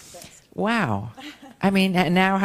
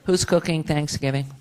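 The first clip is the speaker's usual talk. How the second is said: ordinary delivery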